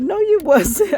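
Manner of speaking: high indignant laughing no; laughing protest. Retold or imitated. Indignant denial to line cutter.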